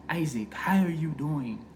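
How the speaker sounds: put-on hushed, smooth African-accented father; father's African accent. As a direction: imitate a soft-spoken African dad. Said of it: "He had a real smooth voice".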